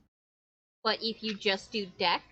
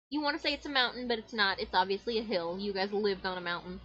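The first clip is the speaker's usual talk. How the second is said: ordinary talk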